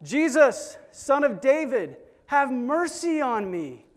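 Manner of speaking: high, loud desperate plea; crying out. Retold or imitated. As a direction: beg for mercy from afar. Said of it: "And he cries out".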